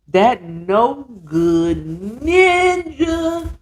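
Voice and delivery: hugely drawn-out, rising disgust; slow, mock-dramatic villain announcement; dramatic reveal. Real, not acted. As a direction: stretch every word out, climbing at the end.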